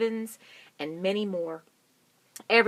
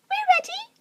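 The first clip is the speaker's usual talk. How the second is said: very high chirpy answer; high bird chorus; 10 dB louder than usual. Acted. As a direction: chirp it as little birds. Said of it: "said the birds".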